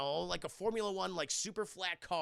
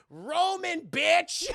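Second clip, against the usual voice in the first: high, loud shouted announcement; hammed-up shout; mock proclamation; 11 semitones higher, 10 dB louder, much slower than usual. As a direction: belt out the name like a banner. Reading plane's painted name.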